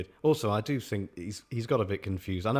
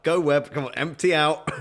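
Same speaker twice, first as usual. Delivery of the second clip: louder, higher shouted command; mock shout; 5 semitones higher, 5 dB louder than usual. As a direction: shout it like a jokey order to your web. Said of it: "and shout".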